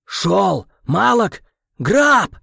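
high, shouting gruff voice; growling Krem. A narrator's reading. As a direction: bark the alarm in character. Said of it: "прорычал Крэм".

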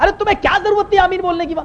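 high-pitched, indignant complaint; imitating exasperated reproach. Companion blames him for saying Ameen, high exasperated reproach. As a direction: whine at him in disbelief.

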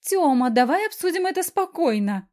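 high, loud panicky squeal; shrieking. A narrator's reading. Wife fleeing her husband begs to talk, shrieking. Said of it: "заверещала".

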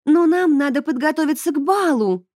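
put-on high, squeaky girl's voice. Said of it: "пискнула Исмара".

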